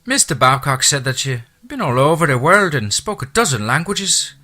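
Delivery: high, loud Irish housekeeper voice; chatty Irish brogue, pitched high. Acted. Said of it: "her lyrical accent".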